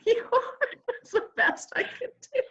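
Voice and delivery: high, slow words through laughter; laughing. Real, not acted. Guest excuses her accent attempt to host, laughing. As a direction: laugh helplessly while excusing yourself.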